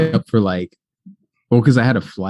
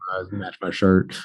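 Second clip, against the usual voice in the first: country accent slipping out; 8 dB quieter than usual. Real, not acted. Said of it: "my accent comes up my shirt".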